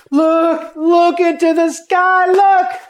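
loud, very high astonished cry; high shouts with a slow stretched middle; crowd shouting. Retold or imitated. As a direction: call out in amazement to everyone. Crowd sees sign in sky.

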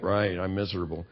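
low, soft, resigned agreement; mock misery. Preacher voices addict answering the programme, mock glum. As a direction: lightly imitate a glum admission.

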